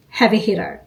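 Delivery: ordinary talk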